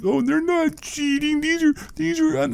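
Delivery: high mocking fanboy whine; mock the crying fans up high; whiny fan voice. Vlogger mocking fans who defend cheaters, high whine. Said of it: "little fans are crying for 'em, like".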